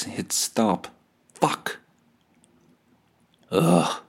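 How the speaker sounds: ordinary talk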